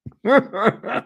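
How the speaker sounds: squeaky high laugh; high cackle. Real, not acted. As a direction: cackle up high at his own joke. Laugh after his punchline.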